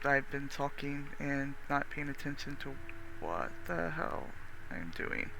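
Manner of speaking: muttered. Real, not acted. Frustrated muttering to self.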